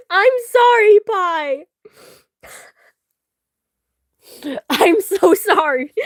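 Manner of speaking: loud, high apology through giggles; laughing apology. Real, not acted. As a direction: cry sorry while cracking up.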